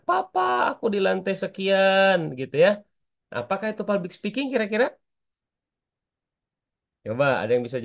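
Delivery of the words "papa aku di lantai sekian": slow, higher, lightly voiced child calling; mock child yell, a bit higher; child shouting. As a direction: stretch it out like a kid calling across the mall. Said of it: "anak Herli tadi berteriak".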